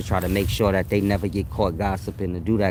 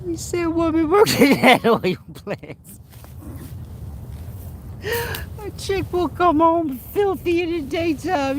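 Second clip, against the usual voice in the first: laughing. Real, not acted. Cracking up at own joke.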